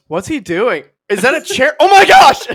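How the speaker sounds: building to a high, loud squeal; excited fan. Retold or imitated. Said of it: "she goes".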